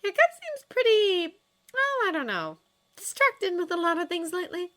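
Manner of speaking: high-pitched character voice feigning casualness; animated, hesitant hinting; Astrid probing. A narrator's reading.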